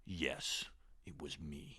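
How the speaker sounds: overacted breathy confession whisper; corny dramatic whisper. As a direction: whisper it like a bad TV actor. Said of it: "they'll be like".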